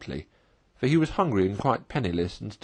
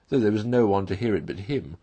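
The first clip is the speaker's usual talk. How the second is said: ordinary delivery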